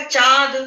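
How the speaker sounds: चाँद pronounced चाद, all through the mouth; no nasal sound. Shown as a demonstration. Teacher asks how it is pronounced, demonstrates it without nasality. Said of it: "ये कैसे उच्चारण करा जाएगा".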